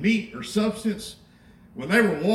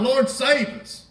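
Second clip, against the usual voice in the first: higher, louder and slow plea for rescue; frightened cry; 6 semitones higher, 4 dB louder, slower than usual. Acted. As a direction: cry out to God in the storm. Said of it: "in a storm crying to God".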